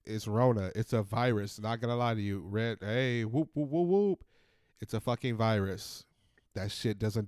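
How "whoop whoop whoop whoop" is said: imitated siren.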